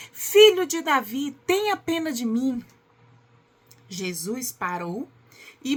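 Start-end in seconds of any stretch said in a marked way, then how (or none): 0.1-2.6 loud, high, insistent plea; projected narrator's shout of a desperate beggar; shouting even more. A narrator's reading. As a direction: cry out harder for mercy over those hushing you. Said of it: "ele gritava ainda mais".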